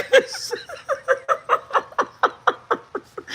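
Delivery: airless breathy laugh fit; silent wheezing laugh. Real, not acted. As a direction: laugh with no voice left.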